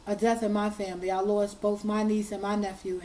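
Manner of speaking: usual speaking voice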